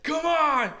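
high, loud hype-up yell; imitated shout. Opener holding the lotion out, imitated hype shout. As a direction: egg the crowd on loudly. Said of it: "He's like".

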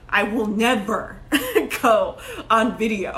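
slow, stressed never, breaking into laughter; laughing insistence. Retold or imitated. Telling her first business coach she'll never film, laughing. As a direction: drag it out and crack up midway. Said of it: "I remember telling my first business coach".

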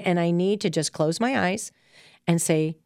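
normal talking voice

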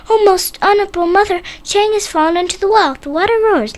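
put-on high, breathless little boy; urgent child's plea. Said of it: "ran as fast".

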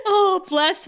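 ordinary delivery

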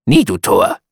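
high, loud bird voice; raven's refrain. A narrator's reading. Raven answers the grieving narrator, high and loud. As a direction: cry it out as the raven. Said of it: "Sprach der Rabe".

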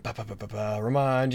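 ordinary talk

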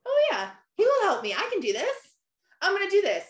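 high, rising-confidence burst of realization; retold elation. Acting out her reply to God's promise, high and excited. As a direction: get fired up, pitch leaping well up. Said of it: "And I'm like".

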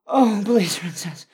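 high loud begging cry; loud pleading. Acted. Loud plea.

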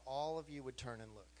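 ordinary talk, nothing marked

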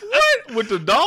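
high, loud incredulous outburst; can't believe what you just heard; shocked disbelief. Real, not acted. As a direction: blurt it out in real astonishment. Shocked reaction to doll story.

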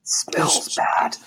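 voiceless hushed remark; whispered. Acted. Cat confides to companion about the kobold, whispering. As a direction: whisper it as an aside.

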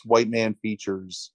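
normal talking voice

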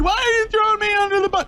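squeaky fast mocking whine of a hurt woman; high fast falsetto mock complaint. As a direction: mimic her as petulant and whiny. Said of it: "She said".

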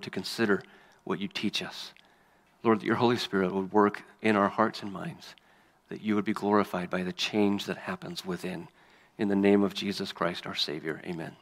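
soft, low-key prayer with long pauses. Real, not acted. Preacher asks God to help them understand his word, hushed prayer. Let's pray and ask him.

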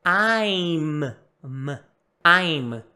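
"I'm" with a long closed m; correct I'M. Shown as a demonstration. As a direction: isolate the m, then say "I'm" firmly. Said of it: "treina bem esse". ("I'm" com m mudo e fechado)

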